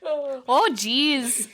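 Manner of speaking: mock Minnesota accent; exaggerated Minnesota interjection. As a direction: stretch it out in a folksy Minnesota accent. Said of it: "talk like that in Minnesota".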